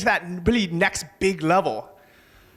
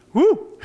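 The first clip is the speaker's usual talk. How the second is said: short, high, loud woo; high-pitched celebratory "woo"; whooped cheer; 14 semitones higher, 5 dB louder than usual. Real, not acted. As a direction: let out a quick excited whoop. Cheer after naming his book.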